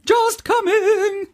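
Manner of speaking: very high, slow chime of Just coming!; chimed in. Retold or imitated. Girl turning round and chiming in, slow high. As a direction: sing it out slowly and majestically. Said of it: "chimed in".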